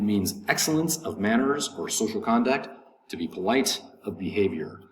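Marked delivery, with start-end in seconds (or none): none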